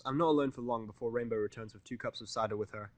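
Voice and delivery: plain reading voice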